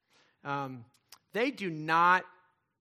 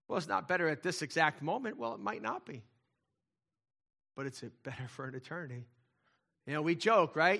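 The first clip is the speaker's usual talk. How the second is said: ordinary delivery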